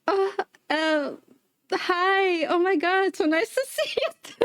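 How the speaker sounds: high, forced polite hello breaking into a laugh; fake politeness. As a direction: pretend to know her, sweetly. Fake-polite greeting to stranger.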